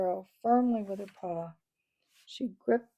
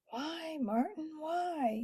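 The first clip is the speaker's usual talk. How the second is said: moaned; 8 semitones higher, much slower than usual. Acted. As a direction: moan it out in frustration. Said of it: "he moaned".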